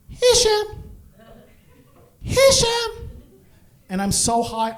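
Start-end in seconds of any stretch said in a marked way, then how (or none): 0.1-3.1 much higher calling voice of a mother. Retold or imitated. Mom calling her son from the darkness, high call. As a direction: call his name like his mom from the dark. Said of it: "All I hear is".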